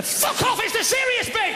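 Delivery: sudden high-pitched indignant outburst; hammed-up angry telling-off; mock outrage. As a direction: snap out of solemnity into a high protest. Mock scolding laughers during tribute.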